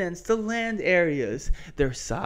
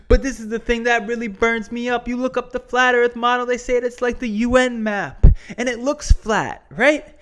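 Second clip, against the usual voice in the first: wrestling promo; 5 semitones higher, 3 dB louder than usual. Pretend. Fired-up promo rant returns.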